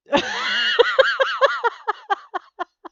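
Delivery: loud high laugh shout; shrieking laugh. Real, not acted. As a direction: let out a howl of laughter. Laughing at her own story.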